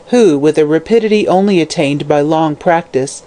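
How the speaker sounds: usual speaking voice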